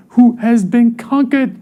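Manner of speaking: rising preacher's cry; triumphant preaching. Acted. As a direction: lift it up, the enemy is beaten. Preaching climax on Satan defeated.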